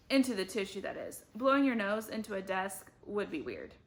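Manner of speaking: plain reading voice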